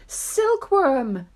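high, loud call to a helper; urgent yell. Acted. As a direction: yell it out, drawing out the word. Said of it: "yelled James".

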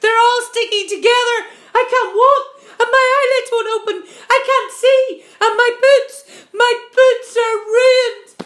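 high, loud wailing complaint, slowing at the end; crying out. Acted. Centipede wailing about his ruined boots, high and loud. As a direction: howl it out, stretching the last words. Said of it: "he cried".

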